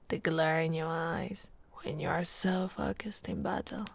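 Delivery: soft, low adoring whisper; hushed lovestruck murmur; admiring whisper. Acted. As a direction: whisper it as if still smitten. Admiring him in battle.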